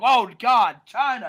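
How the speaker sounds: loud mock-panicked gasp of a name; jolted mock fear at her entrance; mock scared. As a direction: play a sudden fright, hammed-up. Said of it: "looks, like, scared".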